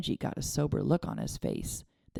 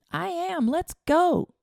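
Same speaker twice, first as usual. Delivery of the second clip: put-on higher, gleeful boy's cry; slower than usual. A narrator's reading. Boy answering his father's friend, gleeful. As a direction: burst out ready to go. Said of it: "Charlie cried gleefully".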